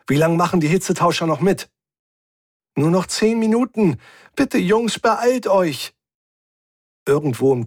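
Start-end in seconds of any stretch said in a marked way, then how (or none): 0.1-1.7 higher, quick urgent question; hurried call over the radio; calling into the comm. A narrator's reading. Captain asks about heat exchangers, calling urgently. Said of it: "rief ich ins Komm". 2.8-5.9 high, urgent female-character voice; anxious pleading. A narrator's reading. Crewmate warns of ten minutes left, anxiously pleading.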